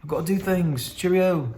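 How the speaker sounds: high mock British officer brushing him off; dismissive British colonel. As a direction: play a snooty British colonel. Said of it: "the colonel's like".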